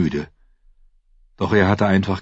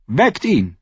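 higher, lightly voiced dwarf lord; Tyrion's voice; 7 semitones higher, 3 dB louder than usual. Tyrion answers the Hound about the horse, higher voice. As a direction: pitch up into Tyrion's quick voice.

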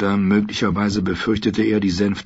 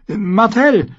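high, loud burst of relief; relieved squire, pitched up; visibly relieved; 14 semitones higher, 5 dB louder than usual. A narrator's reading. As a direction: blurt it out with relief. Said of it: "sichtlich erleichtert".

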